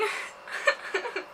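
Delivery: breathy high giggle fit; real giggle; giggling laugh. Real, not acted. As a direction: burst out giggling helplessly. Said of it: "I'm, like, gonna die".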